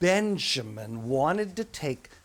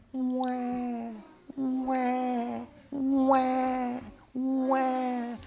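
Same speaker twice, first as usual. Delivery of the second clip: put-on high wailing baby cry; 10 semitones higher, much slower than usual. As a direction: wail like an unhappy baby. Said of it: "and she went".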